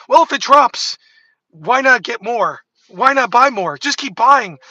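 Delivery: high, loud mock pitch of rich hype men; mocking billionaires. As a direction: parody a billionaire pushing to buy the dip. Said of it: "they're like".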